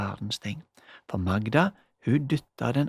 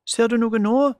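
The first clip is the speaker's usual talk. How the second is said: high, louder call through the door; raised question; 9 semitones higher, 7 dB louder than usual. A narrator's reading. Magda calling to Julie through the closet door, raised. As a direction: ask loudly through the door.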